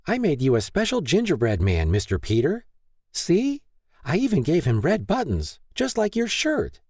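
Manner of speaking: put-on high, beaming child voice; bright little girl. A narrator's reading. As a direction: chatter proudly like a small child. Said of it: "The preschooler brightened".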